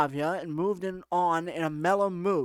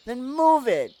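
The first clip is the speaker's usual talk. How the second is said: higher, louder order; put-on bossy girl; 6 semitones higher, 5 dB louder, slower than usual. Alyssa orders him to leave, bossy raised voice. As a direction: send him off with an impatient push.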